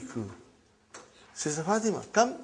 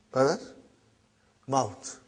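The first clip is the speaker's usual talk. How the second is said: ordinary talk, nothing marked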